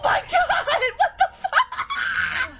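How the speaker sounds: high, shrieking laugh-yell. Real, not acted. Shocked reaction to dying.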